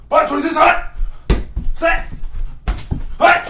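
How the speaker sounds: loud, high, quick-fire snap count; rapid-fire cadence. Pretend. Player rapid-firing throws, high shouted cadence. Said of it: "I'm gonna rapid fire".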